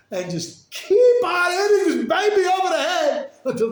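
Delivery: high, loud, slow with a laugh; preacher relaying the rebbe's order, laughing loud and high; laughing disbelief. Real, not acted. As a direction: deliver the shocking line laughing. Shocking instruction, laughing delivery.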